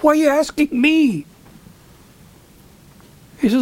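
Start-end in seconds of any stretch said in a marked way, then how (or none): 0.0-1.3 high-pitched retold disbelief; incredulous. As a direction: ask it high, amazed to be consulted. Retells his astonishment at composer asking him.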